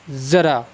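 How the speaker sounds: ज़रा said as zara with a z; ज़रा with the Urdu z sound; z in ज़रा, not j; nukta pronunciation. Shown as a demonstration. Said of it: "फिर मैं इसका उच्चारण करूँगा".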